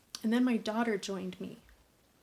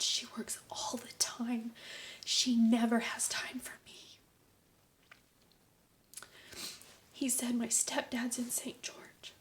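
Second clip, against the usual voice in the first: hushed answers; 2 semitones higher than usual. Retold or imitated. Boy's quiet answers retold.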